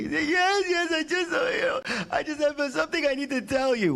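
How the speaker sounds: high, choked-up mother's voice; tearful mom. Retold or imitated. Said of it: "She started crying".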